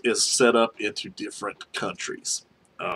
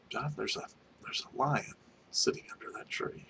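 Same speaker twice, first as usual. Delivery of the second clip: near-whisper, very soft; retold hushed alarm; 3 semitones higher, 14 dB quieter than usual. Coworker spots a lion under the tree, hushed. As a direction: whisper it so the animal will not notice.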